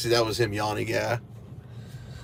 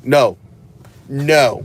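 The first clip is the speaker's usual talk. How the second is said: louder firm no to the dog; firm scold; 5 dB louder than usual. Real, not acted. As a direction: stop the dog sharply.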